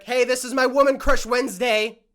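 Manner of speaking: loud mock show-off; hammed-up loud greeting; mock announcement. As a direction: announce it loudly, imitating someone bragging to a stranger. Acting out showing off to a stranger.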